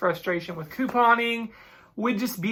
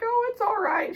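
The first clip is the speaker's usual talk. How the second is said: much higher, bubbly imitation of an excited cashier. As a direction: mimic her squealing over it. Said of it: "She's all excited".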